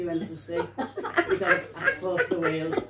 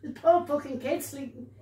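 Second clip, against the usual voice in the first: higher-pitched, indignant telling-off; mock scolding; 6 semitones higher than usual. A woman berating the young lad over the cardboard, mock outrage.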